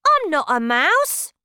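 high and loud insisting; insistent denial. Acted. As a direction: keep denying it loudly. Bruno still denying.

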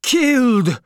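put-on father screaming at the carriage over his dead child; screamed. A narrator's reading. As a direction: howl it out in grief. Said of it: "he screamed".

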